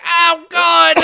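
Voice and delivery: high, loud cry of pain; mock pained yell. Batman crashing across the street, yelling in pain. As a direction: yell as if just slammed down. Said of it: "Oh, that hurt".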